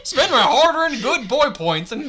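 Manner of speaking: loud, high cartoon character voice; Goofy impression. Performer carries on the requested rhyme, Goofy voice. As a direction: belt it out as a silly cartoon dog. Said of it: "it's just Goofy".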